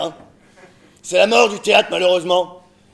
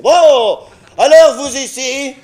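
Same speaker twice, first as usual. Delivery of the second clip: pirate captain; 3 semitones higher than usual. Acted. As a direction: play a theatrical pirate captain. Improviser plays a pirate captain.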